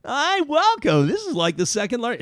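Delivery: high, silly voice of a premier dodging blame; mocking the premier. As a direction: play the premier as a squeaky, clueless fool. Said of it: "mock premier at the wildfire".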